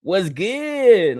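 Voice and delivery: normal talking voice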